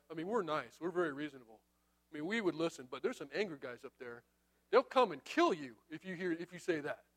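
soft, low veiled threat; understated, confidential menace; mock reasonable. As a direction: play the nice guy delivering a warning.

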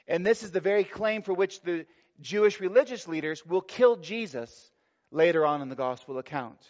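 ordinary talk

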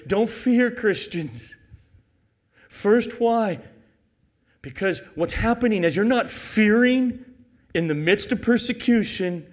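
loud, high, urgent exhortation; full-force preaching. Real, not acted. Preacher telling Christians not to fear, full-force preaching. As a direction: preach it out with punched pauses.

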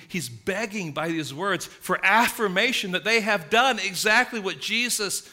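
ordinary talk, nothing marked

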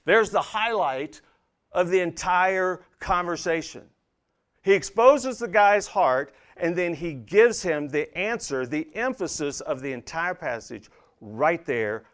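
high, loud pulpit emphasis; emphatic preaching. Real, not acted. Preacher pointing listeners to the passage's highlight, emphatic preaching. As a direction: build to the key point with ringing force.

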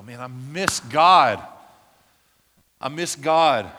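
loud, slow cry of longing for God. Pretend. Pastor voicing the wanderer's longing, loud and aching. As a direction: call out your ache for God.

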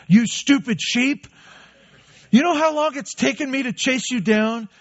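hammed-up furious shepherd shaking the sheep; mock angry shepherd. Shepherd yelling at a stray sheep, mock angry shout. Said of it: "No, that's not it at all".

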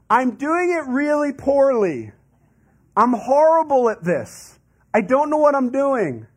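whiny complaint. Acted. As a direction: moan about failing. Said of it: "what we're saying down there is".

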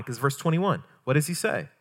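usual speaking voice

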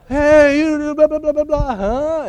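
high, loud teasing shout over the crowd; mock heckling shout. Japanese fans turning back to the Americans, high loud teasing shout. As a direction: yell it up high like a tipsy fan taunting. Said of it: "they would look back at us like".

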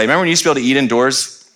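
ordinary talk, nothing marked

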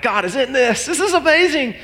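high, thrilled exclamation rising on amazing; excited. Retold or imitated. Pastor recalling his joy at the sale, excited and high. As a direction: gush with delight. Excited self-quote, big pitch rise.

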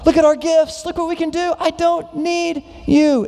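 self-sufficient church boasting before God; proud Corinthians. Retold or imitated. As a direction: brag to God like a spoiled child. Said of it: "They were saying".

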